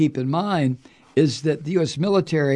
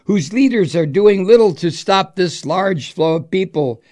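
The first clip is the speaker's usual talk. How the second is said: mock Trump quote; 4 semitones higher, 5 dB louder than usual.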